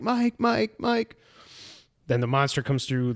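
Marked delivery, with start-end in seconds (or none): 0.0-1.2 weak pleading call. Retold or imitated. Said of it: "she's like".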